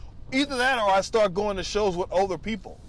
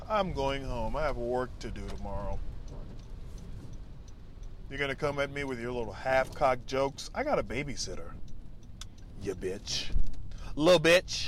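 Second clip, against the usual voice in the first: mock older comic; 4 semitones lower than usual. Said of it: "you ain't just gonna be".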